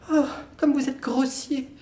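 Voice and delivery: high woman's voice breaking into a cry; hurt and tearful at his rudeness; offended cry. Acted.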